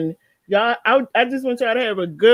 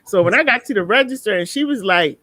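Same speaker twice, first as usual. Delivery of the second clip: normal talking voice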